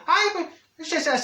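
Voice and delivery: a startled high squeak of a start; sudden high pitch. Acted. [name] catching herself mid-story, high yelp.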